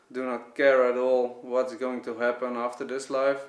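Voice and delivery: usual speaking voice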